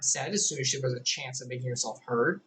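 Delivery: plain reading voice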